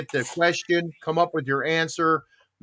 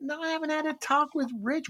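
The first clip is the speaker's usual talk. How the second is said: innocent denial; 11 semitones higher, 6 dB quieter than usual. Retold or imitated. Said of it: "I went".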